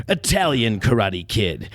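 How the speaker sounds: ordinary talk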